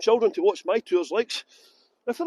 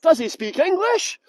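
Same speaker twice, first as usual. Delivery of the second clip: a mildly mock baffled foreign viewer, a touch higher and louder; puzzled newcomer; 6 semitones higher, 3 dB louder than usual. Guide mimicking new platform viewers, mock baffled. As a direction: lightly put on a confused outsider. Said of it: "they'll be like".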